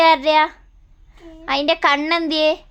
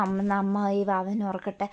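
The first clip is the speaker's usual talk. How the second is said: tender soothing; 4 semitones lower than usual. Real, not acted. Mother putting crying baby to sleep, soothing.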